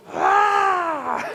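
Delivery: high yelled Ah going into the rapids; retold scream. Preacher reliving the scream in the canoe, high yelled Ah. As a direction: let out a high panicked cry. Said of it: "when you scream".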